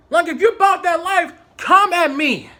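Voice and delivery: confrontational dare. Retold or imitated. Daring coworker to fight, confrontational. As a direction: dare him to step up, forcefully.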